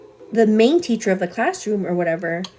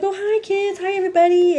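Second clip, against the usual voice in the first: put-on much higher, bright hello to the children; sing-song kid greeting; 12 semitones higher than usual. Mom greeting her kids at daycare pickup, high sing-song hello. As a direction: greet toddlers in a high cheery voice.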